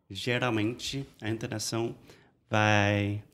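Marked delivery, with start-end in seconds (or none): none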